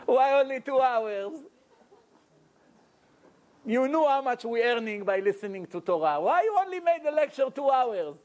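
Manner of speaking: mock complaint, rising into high-pitched protest; acted reproach. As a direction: plead and scold as a dissatisfied listener. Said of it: "you're gonna hold me and say".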